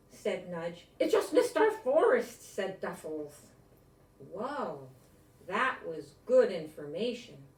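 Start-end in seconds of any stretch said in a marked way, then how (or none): 1.0-2.4 high and loud, finishing a friend's thought; excited exclamation. A narrator's reading. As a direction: burst in eagerly to finish the sentence. Otter finishing friend's sentence.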